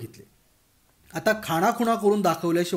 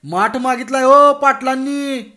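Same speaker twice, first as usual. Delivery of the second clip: loud, slow, higher call to the house; 8 semitones higher, 10 dB louder than usual. Acted. Bhagwan calling into Patil's house, loud slow call. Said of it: "त्याने ओरडून सांगितले".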